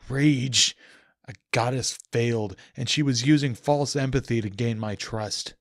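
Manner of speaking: fury bleeding into the narration; seething rage. Acted. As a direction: spit the word rage and keep burning.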